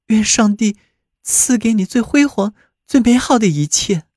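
put-on high, heartfelt young woman's voice; sighing blessing. A narrator's reading. Babette wishes Rudy God's best, sighing and high-pitched. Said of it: "巴贝特叹道".

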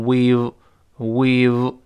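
we've drawn out slowly; slow teacher demo of we've; model pronunciation. Shown as a demonstration. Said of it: "读成we've".

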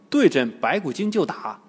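ordinary delivery

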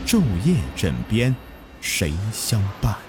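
hushed horror programme announcer; eerie show intro. Acted. Recorded-style ghost story programme opening.

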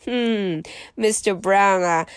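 ordinary delivery, nothing marked

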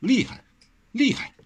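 amazed, pitch leaping up; impressed tongue-click. A narrator's reading. Wang Xiaoshi marvels at the father's poisons, amazed. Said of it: "咂舌道".

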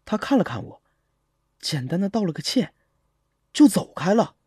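usual speaking voice

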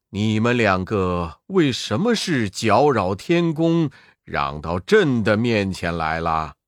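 low, stately emperor voice; a ruler scolding from the throne; deep imperial rebuke; stern emperor. Acted. Jade Emperor scolds two Wukongs.

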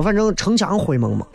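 usual speaking voice

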